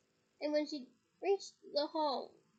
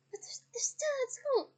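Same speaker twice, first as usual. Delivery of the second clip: much higher, voice breaking into tears; tearful protest; 10 semitones higher, 4 dB louder than usual. Acted. Mother pleading to her husband, tearful and high.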